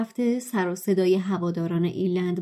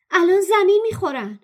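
high, loud shriek; 10 semitones higher, 6 dB louder than usual. Acted. Hermione crying out that the players will crash, high loud shriek. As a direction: shriek in alarm. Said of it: "هرماینی با جیغ گفت".

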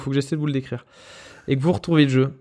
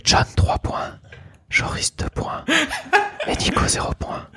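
breathy ASMR whisper of the scores; 14 semitones higher, slower than usual. Pretend. Host reading quiz scores to players in ASMR whisper. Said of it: "On peut faire les scores en A S M R".

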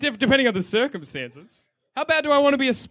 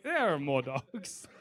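mock cold shrug; 9 semitones lower, 11 dB quieter, slower than usual. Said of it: "you're like".

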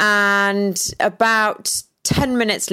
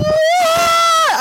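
anguished scream; 7 dB louder than usual. Retold or imitated. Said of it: "And then I started screaming".

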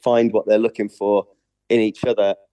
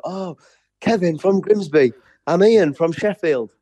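a touch higher, keen fan at the stage door; imitating eager fans; 7 semitones higher than usual. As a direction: lightly mimic a fan introducing himself.

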